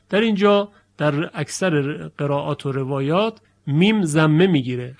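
ordinary delivery

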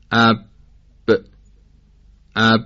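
اب with the lips shut, then the b popped out; abrupt forceful b. Shown as a demonstration. Teacher demonstrates the letter ب, اب with an explosive, unstretchable b. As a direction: stop اب dead and release the b sharply, no stretching. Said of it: "صدا با حالتی دفعی همراه با شدت".

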